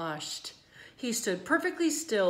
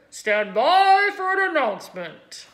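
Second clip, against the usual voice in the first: booming loudspeaker; 7 semitones higher, 12 dB louder than usual. A narrator's reading. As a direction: boom it to the grandstand. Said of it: "boomed the voice of the loudspeaker".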